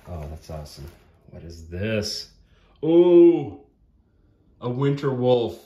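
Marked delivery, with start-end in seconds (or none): 2.8-5.6 loud, high, drawn-out exclamation; delighted surprise. Real, not acted. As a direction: burst out at a thrilling discovery. Delighted discovery of next miniature.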